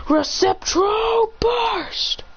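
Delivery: very high, long-stretched battle cry; hammed-up anime attack yell; attack call. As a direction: stretch the move name out high. Ida calls out his attack.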